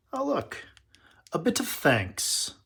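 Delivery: high delighted oh, look; surprised and charmed; pleasant surprise. Real, not acted. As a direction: squeal a little at the find. Surprise at a note in box.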